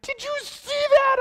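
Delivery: high, loud squeal; amazed excitement. Retold or imitated. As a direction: imitate an overjoyed young woman, way up high. Young woman amazed after dismissal, retold.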